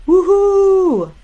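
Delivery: long, loud, high "woohoo"; high-pitched cheer held long; celebratory whoop. Real, not acted. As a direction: whoop it out at full voice. Cheering for activism.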